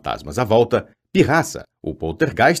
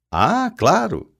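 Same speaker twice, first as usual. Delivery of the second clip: fake agreement; 9 semitones higher, much slower than usual. A narrator's reading.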